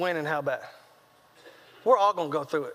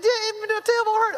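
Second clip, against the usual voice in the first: put-on high-pitched whimpery kid voice; hammed-up injured child; whining hurt son; 11 semitones higher than usual. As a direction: answer like a boy in pain. Hurt son answering his dad.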